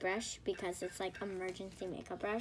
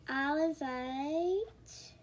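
normal talking voice

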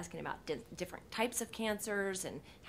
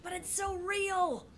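whiny protest; 12 semitones higher, slower than usual. Retold or imitated. Mock-earnest retort to network note.

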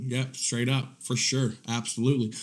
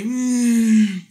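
unimpressed; 7 semitones higher, 4 dB louder than usual. Retold or imitated. Unimpressed grunt.

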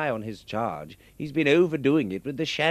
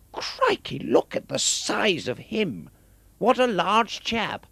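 startled high gasp; 8 semitones higher, 3 dB louder than usual. Acted. Toff sees a big thug.

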